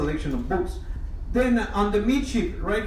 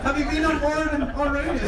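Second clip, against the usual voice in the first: laughing teasing question, pitched high; 7 semitones higher than usual. Real, not acted. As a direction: tease the guests while cracking up. Joking with guests about toilets.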